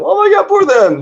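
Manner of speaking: very high squeaky whimper of pity; mock pity. Dante pitying the sinners, hammed-up mock pity. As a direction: gush with pity in a tiny high voice.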